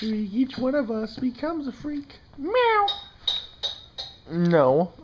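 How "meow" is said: high, playful kitten meow; much higher cute meow; mock meow. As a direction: mew like a kitten. Meowing at kittens.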